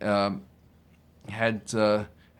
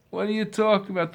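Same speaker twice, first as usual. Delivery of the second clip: bewildered denial; 8 semitones higher, much faster than usual. Acted. Accused man denies, bewildered.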